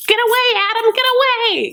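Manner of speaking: high squealing get away; mock squeal. Stephanie's earrings shooing Adam off, in a high squeal. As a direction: shriek it high like a panicked cartoon.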